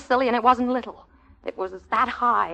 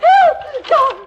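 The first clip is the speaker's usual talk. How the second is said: high, loud cry for help; frightened yelp at a mouse; panicked scream; 14 semitones higher, 6 dB louder than usual. Acted.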